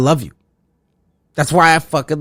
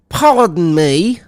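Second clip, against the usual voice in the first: slow mock disbelief; 5 semitones higher, much slower than usual. Real, not acted. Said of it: "mock disbelief".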